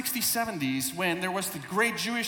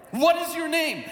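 commanding question; 6 semitones higher, 4 dB louder than usual. Acted. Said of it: "Not quite familiar with how demons speak".

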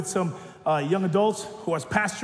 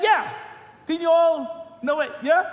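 put-on casual young man; 12 semitones higher, 5 dB louder than usual. Young man voiced in retelling.

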